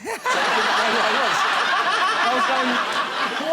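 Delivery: high squeaky laughter; laughing. Real, not acted. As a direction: laugh through the words. Laughing hard at the memory.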